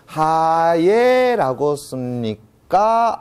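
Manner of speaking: 하예 drawn out very slowly, syllable by syllable; 하예 said very slowly and deliberately; spelling-out pronunciation. Shown as a demonstration. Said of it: "이, 이렇게 씁니까?".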